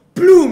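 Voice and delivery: loud, high mimicked crash; sudden shouted "plum"; imitated crash. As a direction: burst out the noise of a smash.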